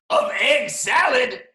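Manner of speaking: high, loud, drawn-out punchline; gleeful octopus reveal. Acted. Octopus surprises the boy with his bath, loud proud reveal. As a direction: announce it like a grand surprise.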